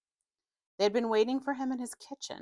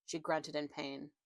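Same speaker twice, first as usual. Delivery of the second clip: ordinary delivery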